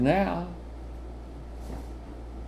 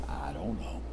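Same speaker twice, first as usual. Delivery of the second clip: put-on much lower, much softer mumbled kid reply; sulky kid; 13 semitones lower, 17 dB quieter than usual. Child answering parent about mess, mumbled. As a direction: shrug it off like a guilty child. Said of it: "what you always ask kids".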